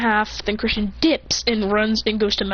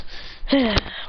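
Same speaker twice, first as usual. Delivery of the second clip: short breathy exhale; acted sigh. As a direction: sigh before giving in. Character sighs before giving in.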